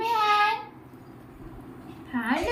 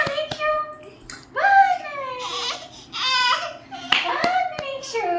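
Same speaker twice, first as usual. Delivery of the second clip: cooing praise; 9 semitones higher, 4 dB louder, slower than usual. Real, not acted. Mother telling little Nikshu it looks nice, cooing.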